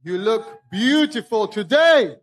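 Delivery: loud call to the crowd; rally speech. Real, not acted. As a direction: hype up the crowd.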